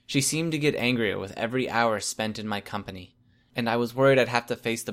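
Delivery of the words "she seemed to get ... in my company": ordinary delivery, nothing marked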